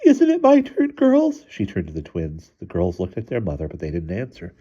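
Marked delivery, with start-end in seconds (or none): 0.0-1.5 loud, very high tearful plea; bawling appeal. A narrator's reading. Mother appealing to the twins for support, bawling. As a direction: wail the question high and loud. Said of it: "began to bawl".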